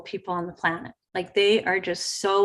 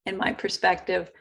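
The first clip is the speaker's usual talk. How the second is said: normal talking voice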